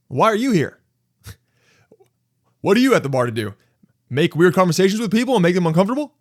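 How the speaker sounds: loud, high, accusing; confrontational. Acted. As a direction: call them out loudly. Acted bar confrontation.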